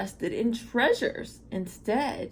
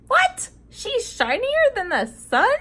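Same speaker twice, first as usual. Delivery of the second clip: much higher, louder, amazed disbelief; mock surprise; 13 semitones higher, 6 dB louder than usual. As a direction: gasp it out as if it's impossible. Reacts to shining princess.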